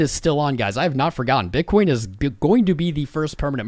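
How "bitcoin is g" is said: normal talking voice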